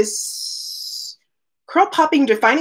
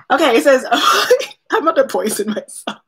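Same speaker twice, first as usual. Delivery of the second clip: ordinary delivery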